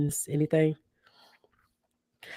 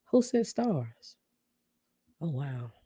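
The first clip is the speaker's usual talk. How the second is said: surprised; 6 dB quieter, slower than usual. Real, not acted. Surprised by a gift of stars.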